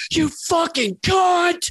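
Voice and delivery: slow, very high screamed insult; furious shout. Retold or imitated. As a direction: let it rip like a comic losing it on stage. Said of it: "he just starts going".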